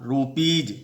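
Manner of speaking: रूपीज ending in a clear ज sound; final s as j. Shown as a demonstration. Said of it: "जौ होगा".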